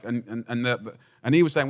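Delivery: ordinary talk, nothing marked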